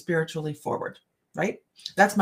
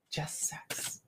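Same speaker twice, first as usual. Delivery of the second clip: whisper; 3 semitones lower, 15 dB quieter than usual. Real, not acted. Quick aside mid-reading.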